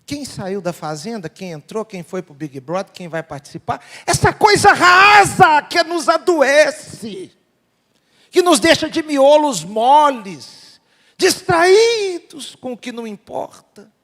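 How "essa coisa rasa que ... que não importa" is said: loud, high, drawn-out pulpit outcry; impassioned slow shout from the pulpit; full-force preaching. Real, not acted. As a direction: thunder it slowly, stretching the key words.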